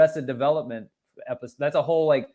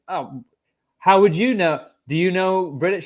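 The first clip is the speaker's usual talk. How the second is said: higher-pitched mock British accent; struggling British accent; 5 semitones higher, 4 dB louder than usual. Faker challenging the woman who doubts him, in strained British accent. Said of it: "keep his British accent together".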